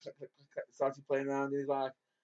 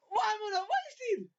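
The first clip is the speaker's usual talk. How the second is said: much higher, half-crying comic shout; mock anguished wail. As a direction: wail it like a tragic loss. Comic lament at the party.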